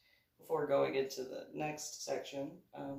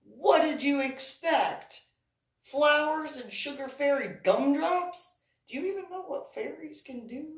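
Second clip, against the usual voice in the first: higher, louder teasing scold at a scared friend; mock scolding; 9 semitones higher, 8 dB louder than usual. To scared Patricia after the ride, mock scolding. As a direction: lecture her as if she's silly. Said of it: "It's like".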